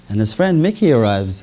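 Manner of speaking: normal talking voice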